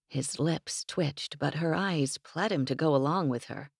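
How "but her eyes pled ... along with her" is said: ordinary delivery, nothing marked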